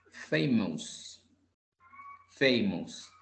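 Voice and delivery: "famous." said carefully as a model, falling at the end; pronunciation demo. Shown as a demonstration. Teacher corrects student's pronunciation, modelling "famous" carefully. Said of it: "la pronunciación sería".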